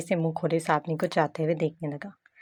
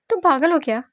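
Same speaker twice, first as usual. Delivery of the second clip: higher, louder disbelief; exasperated outburst; 9 semitones higher, 5 dB louder than usual. Acted. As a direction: throw up your hands at her. (झुँझलाकर चिल्लाया सवाल)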